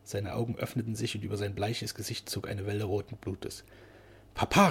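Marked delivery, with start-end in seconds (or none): 4.3-4.7 high, louder urgent warning call. A narrator's reading. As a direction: call out to caution father. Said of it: "warnend, obgleich sie selbst tief erregt war".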